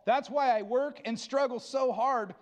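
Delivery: fervent pulpit preaching to the church. Acted. Pastor preaching to his church about Christ's power, fervent. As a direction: preach it with conviction, building.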